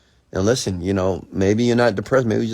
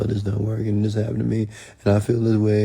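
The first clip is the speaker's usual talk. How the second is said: whining and complaining; 3 semitones higher than usual. Retold or imitated. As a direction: mimic someone endlessly moaning about their life. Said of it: "whining and complaining".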